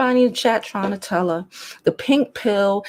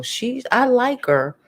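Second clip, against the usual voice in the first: normal talking voice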